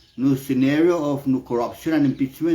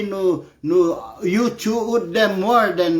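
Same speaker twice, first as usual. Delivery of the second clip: ordinary talk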